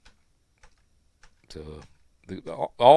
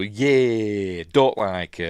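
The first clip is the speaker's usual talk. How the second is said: imitating son; 6 dB louder, much slower than usual. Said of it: "He would go".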